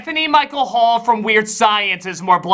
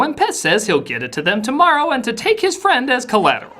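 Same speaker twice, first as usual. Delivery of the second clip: ordinary talk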